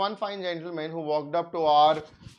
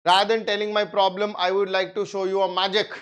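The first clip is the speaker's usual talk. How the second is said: eager patient; 3 semitones higher, 5 dB louder than usual. Retold or imitated. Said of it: "And he says".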